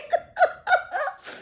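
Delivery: very high hysterical giggle; real high-pitched laughing fit. Real, not acted. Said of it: "I'm getting a little bit hysterical".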